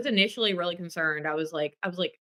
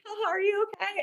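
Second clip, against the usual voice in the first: much higher, laughing-edged fake concern; mock concern; 14 semitones higher, 5 dB quieter than usual. Friend mimicking herself checking on the fallen guitarist, high mock. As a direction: toss off a squeaky are you okay. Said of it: "I was like".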